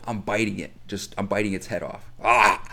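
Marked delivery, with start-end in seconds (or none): 2.2-2.6 loud mock attack yell; loud playful bite shout; loud chomping roar. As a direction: roar like biting a head off. Mock biting stingray head.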